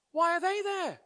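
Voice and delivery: very high, urgent question; alarmed boss. A narrator's reading. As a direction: pitch leaping in acted alarm. Alarmed boss presses the child.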